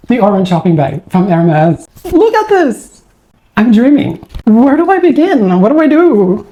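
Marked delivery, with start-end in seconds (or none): 2.1-6.5 high, giddy disbelief; overwhelmed excitement. Real, not acted. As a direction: gush over the orange Hermes bag, much higher. Overwhelmed opening the orange bag.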